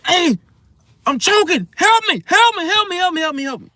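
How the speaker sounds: high, staged panic cries for help; screaming and yelling. Acted. Shopper fakes choking to cause a scene, high panicked yelling. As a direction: fake choking fit, loud then tailing off in rapid pleas. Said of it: "you screaming and yelling".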